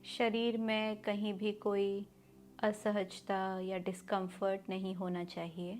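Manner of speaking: slow, measured meditation voice with long pauses; guided meditation. Acted. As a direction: guide them through each breath, drawing the words out. Leads a guided breathing meditation.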